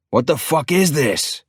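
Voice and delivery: furious yell, pitched high and drawn out. A narrator's reading. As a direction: roar it at the wall in frustration. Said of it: "I yell so loud".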